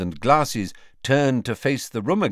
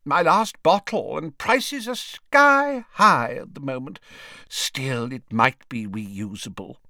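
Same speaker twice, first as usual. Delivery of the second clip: high, put-on wizard voice, settling at the end; fussy wizard's character voice; grumbling old wizard; 5 semitones higher than usual. A narrator's reading. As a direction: complain about costs, pitched high. Voices Slughorn grumbling about his bottle.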